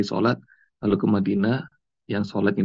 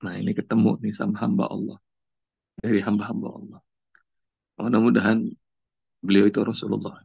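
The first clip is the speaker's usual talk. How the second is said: usual speaking voice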